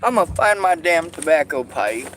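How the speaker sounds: exasperated. Real, not acted. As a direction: announce it, thoroughly fed up. Fed-up declaration after outburst.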